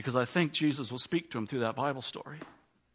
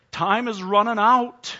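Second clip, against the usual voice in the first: higher, louder and slow, peaking on "out"; urgent preaching shout; 8 semitones higher, 9 dB louder, slower than usual. Acted. Preacher's repeated warning to the congregation, shouted slowly. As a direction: stretch "Time is running out" out, loud and rising. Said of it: "preacher repeats louder".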